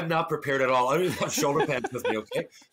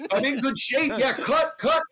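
panicked inner thought; 10 semitones higher, 4 dB louder than usual. Retold or imitated. Retold panic after being punched.

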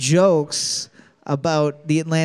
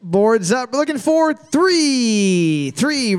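higher, drawn-out announcer call; game show answer reveal; 3 semitones higher, slower than usual. Pretend. Host calls the answer to the teams, drawn-out flourish.

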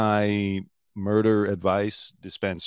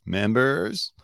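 "Members" higher, sliding up like a question; imitating rising uptalk; 4 semitones higher than usual. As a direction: echo "Members" with an exaggerated upward glide. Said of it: "it's the way his voice goes up".

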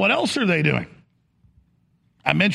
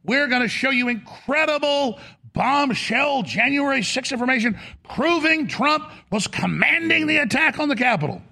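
snake oil seller; 8 semitones higher than usual. Retold or imitated. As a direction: step right up like a ringmaster. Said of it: "the networks promoting".

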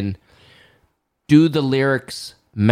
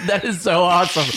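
delighted words through laughter, pitched up; laughing; 6 semitones higher, faster than usual. Real, not acted. Host cracked up by co-host's singing, laughing. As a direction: laugh your way through the praise.

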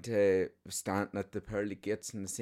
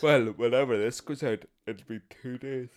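speaking through a big yawn; 3 semitones higher, 4 dB louder than usual. Real, not acted. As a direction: let a yawn stretch the words. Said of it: "my big yawn".

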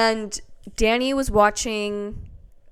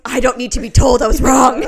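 loud, laughing retold protest; defensive retort; 7 dB louder than usual. Wife retorting to husband's I-told-you-so, mock defensive. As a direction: belt out a mock-defensive comeback.

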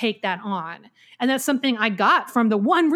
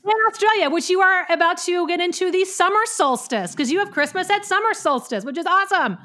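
bubbly and much higher pitched; excited; 8 semitones higher than usual. Real, not acted. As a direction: gush about Australia excitedly. Excited high-pitched aside.

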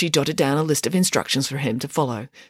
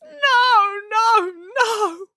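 keen over the lost tree; anguished wailing; grief-stricken wail; much slower than usual. Acted. Anna grieving the felled tree.

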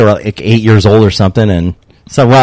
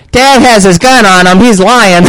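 put-on high, loud tattling little boy; a kid blurting out dad's secret to the cop; snitching kid; 9 semitones higher, 4 dB louder than usual. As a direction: tattle on dad like a gleeful kid.

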